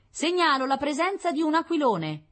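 high, loud reporting voice; scout's radio report. Acted. Scout reporting a kite to the general, high and loud. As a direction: announce the sighting in a high voice.

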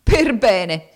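loud, jeering, bursting into laughter; mocking laugh. A narrator's reading. Maid jeering at the girl before leaving, laughing. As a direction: repeat it cackling with scorn. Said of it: "ripeté e dette in uno scoppio di risa".

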